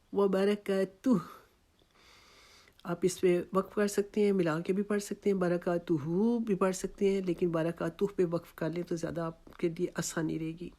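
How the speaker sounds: ordinary delivery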